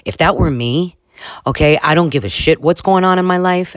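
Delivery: ordinary talk, nothing marked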